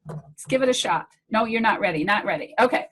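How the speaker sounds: ordinary talk, nothing marked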